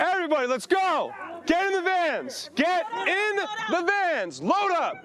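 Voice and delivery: high, carrying holler, words drawn out; real yell to herd everyone away; urgent shouted order. Real, not acted. Host calling crew into the vans, urgent yelling.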